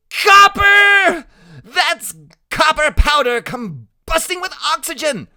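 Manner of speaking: very high, very loud yell; excited scream. A narrator's reading. Freak tugging his friend's hair at fireworks, screaming high. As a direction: scream it out at the top of the voice. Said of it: "Freak tugs my hair and screams".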